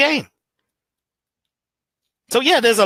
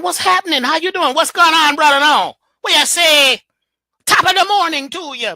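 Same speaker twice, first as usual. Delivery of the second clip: higher, lively, sing-song greetings; speaking with rhythm; 7 semitones higher, 5 dB louder than usual. Shown as a demonstration. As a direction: greet everybody with swing and tone. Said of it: "Speak with some rhythm... You need tone".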